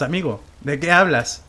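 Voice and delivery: ordinary talk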